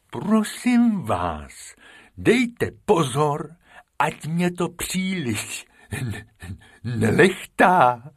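a fussy elephant; Bimbo the elephant. Acted. Bimbo asking the rabbits not to tickle, slow and fussy.

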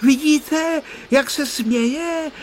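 put-on high, excited child's cry; excited shout. A narrator's reading. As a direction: cry it out, thrilled. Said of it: "vykřikl vzrušeně Honzík". (vzrušeně vykřiknout)

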